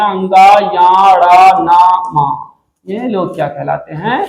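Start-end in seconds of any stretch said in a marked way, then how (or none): 0.0-2.3 slow, drawn-out syllables of अंगा, या; nasal letters demo. Shown as a demonstration. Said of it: "वर्गों के अंतिम पांचवें वर्ण नासिक".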